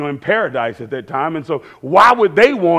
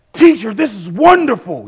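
raised, eager exclamation; gushing with wonder, pitched up; excited; 4 semitones higher, 4 dB louder than usual. Retold or imitated. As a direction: imitate an excited disciple. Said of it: "he's, like, so excited".

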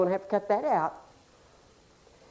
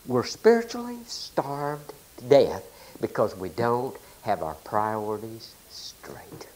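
hushed solemn; 10 semitones lower, 6 dB quieter, slower than usual. Real, not acted. Solemn closing point.

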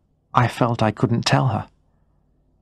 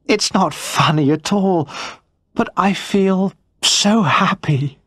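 happy wife; 8 semitones higher than usual. Acted. Wife gently answering her angry husband, relieved. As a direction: reassure him while still glowing with relief.

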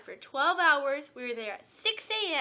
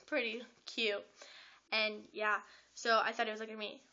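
usual speaking voice

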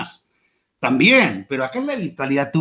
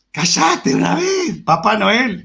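snapping shh; 6 semitones higher, slower than usual. Pretend. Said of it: "ráfagas vocales de shh".

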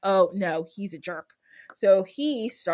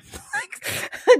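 high laugh; 4 dB louder than usual. Real, not acted. Laughs before shady character.

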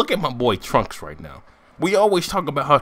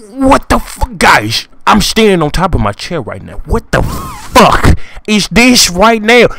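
loud, raised, incredulous outburst; shocked reaction; 6 semitones higher, 8 dB louder than usual. Acted. Vlogger sees Cell turn into a cockroach, loud shocked outburst. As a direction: shout in disbelief, dragging out the key words. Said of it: "This is my reaction to when".

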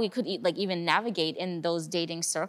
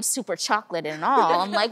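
ordinary delivery

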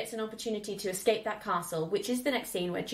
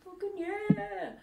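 put-on higher, slow cheer from her childhood self; kid cheering; 11 semitones higher, much slower than usual. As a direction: drag out the cheer like an awed kid.

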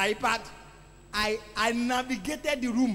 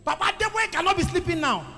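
loud rebuking inner voice; urging spirit; 3 semitones higher, 6 dB louder than usual. Retold or imitated. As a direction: push back hard against the urge to sleep. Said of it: "Another spirit said".

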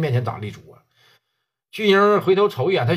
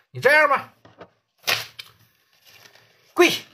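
a high, pushy order to kneel; mock gangster demand; 11 semitones higher than usual. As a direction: bark the order up high like a bully. Gangster forces him to kneel. (模仿恶霸高声喝令他跪下)

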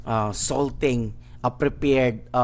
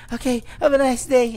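put-on timid girl voice; 13 semitones higher, 5 dB louder, faster than usual. Girl dismissing visitors.

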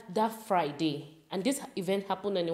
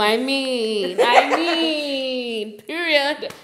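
excited laughing; 4 semitones higher, 9 dB louder, much slower than usual. Real, not acted.

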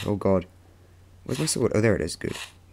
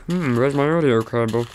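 a put-on version of their own voice; lightly hammed-up puzzled musing; exaggerated self-impression. Player mimics self hunting for the audio cable, lightly put-on voice. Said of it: "That's not what I sound like in real life".

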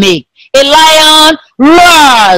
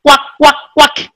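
short, evenly repeated quacks; duck quack; 3 semitones higher than usual. Shown as a demonstration. Teacher shows pupils how a duck quacks, quacking. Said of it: "like a duck".

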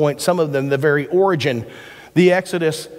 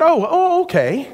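surprised mom; 11 semitones higher than usual. Retold or imitated. Mom caught off guard.

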